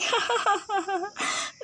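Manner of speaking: high rising giggle; a bubbling, inappropriate giggle. Acted. Bride giggling about leaving home. Bride laughs at sad words.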